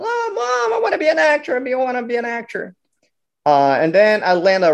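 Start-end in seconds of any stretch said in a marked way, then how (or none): 0.0-2.8 put-on much higher child's plea; boy pleading with his mom to stay an actor; whining kid. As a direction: beg mom like a little kid.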